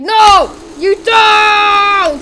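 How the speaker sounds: high, loud furious play-yell; hammed-up enraged shout; screamed refusal. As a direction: scream back, stretching the last word. Killer screams back at crowd.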